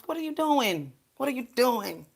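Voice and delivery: high, incredulous what are you doing; mock disbelief. Scolding people who sacrifice animals, high mock disbelief. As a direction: sound appalled at the very idea.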